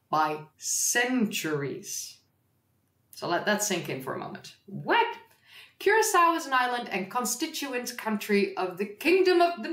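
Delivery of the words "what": usual speaking voice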